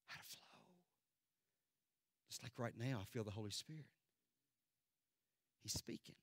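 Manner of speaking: low, very soft preaching; quiet, reverent exhortation; hushed appeal. Real, not acted. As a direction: gently press the congregation, almost whispering at times.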